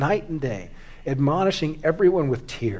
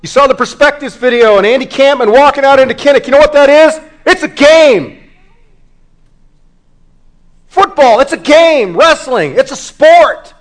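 high, loud, impassioned sermon; full-force preaching; 10 semitones higher, 13 dB louder than usual. Real, not acted. Preacher warning congregation they will face God, full-force preaching. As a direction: preach it hard, pitch and volume up.

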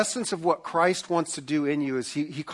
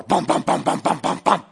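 door knocks; 6 dB louder than usual. Retold or imitated. Imitates banging on the door.

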